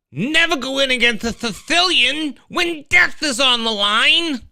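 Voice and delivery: very high, loud character impression; high-pitched, shouted movie villain; Vizzini. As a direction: crow it at the top of your voice. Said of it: "I'll still do the Vizzini".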